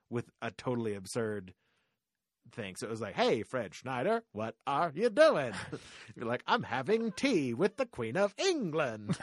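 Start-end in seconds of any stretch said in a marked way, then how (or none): 3.2-5.5 slow, drawn-out chant, louder at the end; a light sing-song B-52s style call. Retold or imitated. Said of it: "everyone had to talk like [name]". 6.5-9.2 slow, higher, louder sing-speak. Retold or imitated. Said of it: "as [name], you had to respond".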